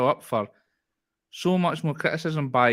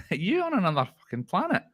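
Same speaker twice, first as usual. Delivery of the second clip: incredulous; 7 semitones higher than usual. Retold or imitated. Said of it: "I'm like".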